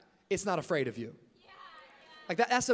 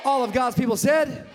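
much louder, higher shout to the church; shouted call; 5 semitones higher, 12 dB louder than usual. Real, not acted. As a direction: demand the answer, shouting it out. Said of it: "and all of God's people said".

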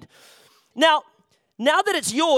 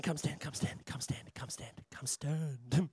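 hushed whisper to a volunteer; whispered aside; 11 semitones lower, 17 dB quieter, slower than usual. Real, not acted. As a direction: whisper it so only he hears.

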